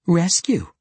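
high-pitched incredulous echo. A narrator's reading. Deli echoing Wulfgar's talk of rescue, incredulous. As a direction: throw the word back in disbelief. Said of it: "Deli echoed incredulously".

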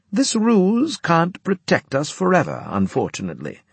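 high-pitched officer voice; Winslade briefing troops. A narrator's reading.

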